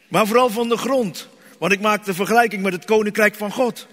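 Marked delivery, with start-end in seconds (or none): none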